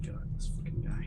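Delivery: exasperated whisper. Acted. Investigator's exasperated aside.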